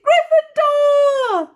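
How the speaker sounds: very high, loud, drawn-out shout; hat shouting. Acted. Sorting Hat announcing Ron's house, big high shout. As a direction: bellow the house name out. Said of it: "the hat had shouted".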